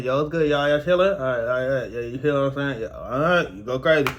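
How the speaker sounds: mumbling with a numb top lip; slurred numb-mouth mumble. Retold or imitated. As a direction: talk without moving the top lip. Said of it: "So I was over here like this".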